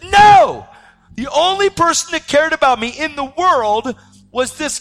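high, anguished shout; reliving grief at full volume; freaking out. Retold or imitated. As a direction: cry out in protest at the loss. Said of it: "I'm freaking out".

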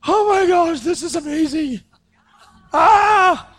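very high-pitched mock squeal of wonder; hammed-up amazement. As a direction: squeal like an overwhelmed disciple, ending in a cry. Acting out amazed disciples.

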